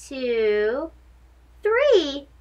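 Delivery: usual speaking voice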